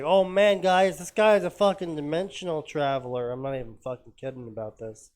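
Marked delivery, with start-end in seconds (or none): 0.0-3.3 loud, high, excited yelling hat; the hat bursts out in amazement; excited hat shout. Retold or imitated. The sorting hat shouts to the class. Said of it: "and the hat's like".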